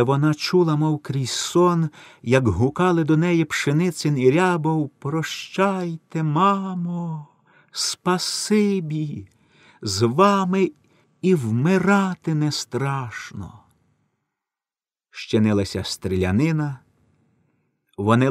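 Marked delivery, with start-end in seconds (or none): 5.0-13.5 tender farewell. A narrator's reading. Condemned airmen thank their adopted mother, slow and tender. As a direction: say goodbye gently to mother.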